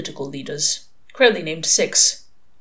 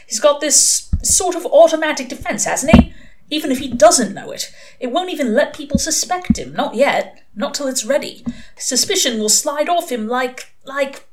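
worked-up demon, raised pitch; higher, insistent demon voice; Crowley; 8 semitones higher, 3 dB louder than usual. A narrator's reading. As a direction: argue it down as an agitated demon.

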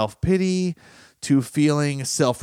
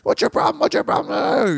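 high, loud mock yell; imitating a shouting family fight; hammed-up angry yell in the kitchen; 13 semitones higher, 3 dB louder than usual. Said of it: "You're yelling real loud".

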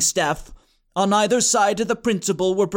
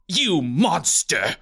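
spat insult; 3 semitones lower, slower than usual. A narrator's reading. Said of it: "Bernard spat".

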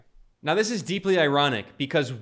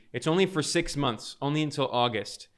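ordinary talk

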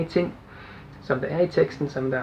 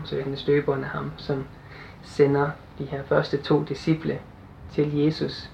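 ordinary talk

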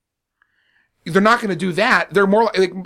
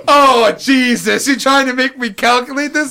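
groaning; 5 semitones higher, 4 dB louder than usual. Retold or imitated. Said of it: "Just the groans of".

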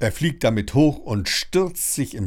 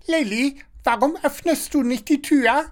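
very high, squawky busybody; high-pitched prattling neighbour; Frau Schnattermann chattering. A narrator's reading. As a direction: chatter it nosily through the door. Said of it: "schnattert Frau Schnattermann".

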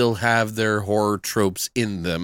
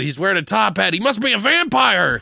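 rising high into pretend panic; hammed-up vampire alarm; mock alarm; 6 semitones higher than usual. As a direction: play up the horrified discovery. Mocking the vampire hunter's alarm.

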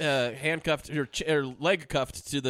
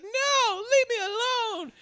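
much higher, slow mock crying wail; mock damsel scream; much slower than usual. High fake cry of a woman calling from the pool. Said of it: "Charlize Theron going like".

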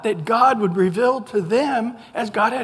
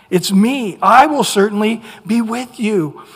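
emphatic preaching; 8 dB louder than usual. Real, not acted. Preacher driving home the point.

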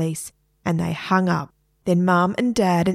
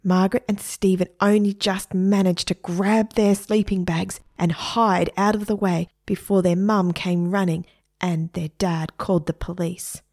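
plain reading voice

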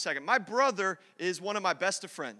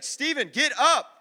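slow, raised, higher retold command; impatient order; 7 semitones higher, 8 dB louder, slower than usual. Preacher retells telling fallen brother to get up, loud impatient order. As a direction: order your brother up loudly.

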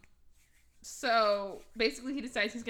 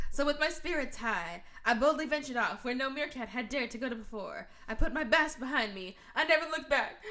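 heroic monologue; 3 semitones higher than usual. Retold or imitated. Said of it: "heroic monologue".

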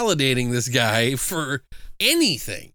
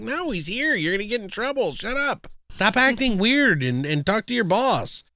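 scolding mom; 8 semitones higher than usual. Retold or imitated. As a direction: shush him like an embarrassed mother. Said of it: "And she's like".

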